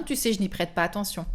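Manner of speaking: usual speaking voice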